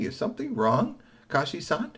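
high, gentle asking; polite robot. Acted. Robot asking Kashi, high polite question. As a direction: ask with courteous care.

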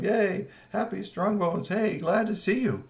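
high cheerful welcome, a bit slow; happy greeting. Acted. Parents welcoming their son home, high and glad.

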